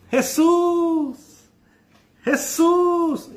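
high, loud desperate cry; blind beggar shouting. Acted. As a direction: call out to Jesus from the roadside. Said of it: "se puso a gritar".

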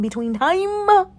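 "between time" high and stretched out; drawn-out catchphrase. Shown as a demonstration. Repeats her catchphrase after mock heckle.

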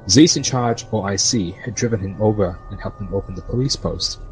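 normal talking voice

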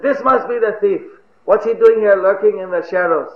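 accusing. Acted. Pursuers who found a watchman, mildly acted accusation. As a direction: pounce on the suspect.

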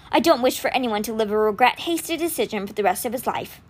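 ordinary delivery, nothing marked

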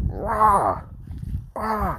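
high mock screams of an old man; retold old man screaming. Old man screaming at the train station, high mock yell. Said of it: "Someone screaming".

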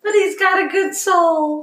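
high, slow teasing; sing-song teasing. Pretend. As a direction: tease her sweetly, slowly.

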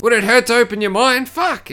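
hammed-up character impression; mock whiny outburst. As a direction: squeal it like an exasperated teen.

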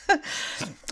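breathy little laugh; a quick airy chuckle; light unvoiced laugh. Real, not acted. After describing contagious laughter, airy laugh.

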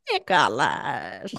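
put-on low, slow old grandma voice; imitating Nick Swardson's grandma calling his name; grandma impression. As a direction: drag it out like a croaky old grandma. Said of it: "his impersonation for his grandma saying".

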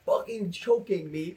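ordinary delivery

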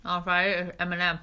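quick words round a sweet; mouth full. Real, not acted. Host snacking before a pause, mouth full. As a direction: talk while eating candy. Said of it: "eating with your mouth full".

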